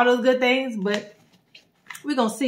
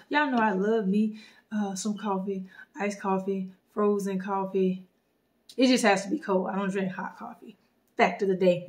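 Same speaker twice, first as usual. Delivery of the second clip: ordinary talk, nothing marked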